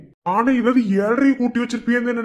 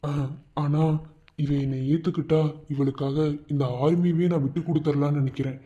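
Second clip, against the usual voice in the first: low, soft, heavy confession; quiet resolve; 6 semitones lower, 5 dB quieter than usual. Acted. Husband quietly tells his mother he will stay away. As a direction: say it softly, almost to yourself.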